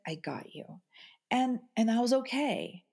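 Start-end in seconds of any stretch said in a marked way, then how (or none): 0.1-0.8 low, soft, steady reassurance; tender reassurance. Retold or imitated. Husband calming his panicking wife, low and soft. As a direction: say it like holding someone close. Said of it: "he said".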